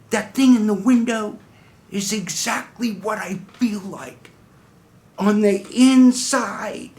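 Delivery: high-pitched, dawning wonder; amazed revelation. Acted. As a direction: proclaim a stunning discovery about yourself. Monologue's big revelation of true identity.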